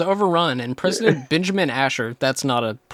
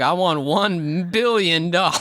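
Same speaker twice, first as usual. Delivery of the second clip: Doctor Evil impression; 3 semitones higher, slower than usual. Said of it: "Doctor Evil is like".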